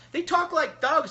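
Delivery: slow, high, loud disdain for the thugs; scornful. Real, not acted. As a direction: spit it out with high, loud contempt. Scornful about people talking like thugs.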